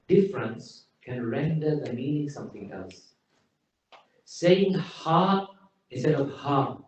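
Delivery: ordinary talk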